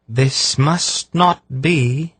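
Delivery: high, slow, clear ringing seer; solemn seer, slow and ringing. A narrator's reading. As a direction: ring out like an otherworldly oracle. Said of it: "in her clear, ringing voice".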